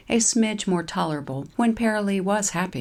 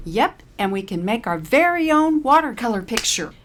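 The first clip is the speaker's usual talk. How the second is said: high, bright cheery voice; grinning; 7 semitones higher, 6 dB louder than usual. Acted. Caroline cheers Paralee up about painting, high and bright. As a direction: grin at her friend. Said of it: "aims her signature grin".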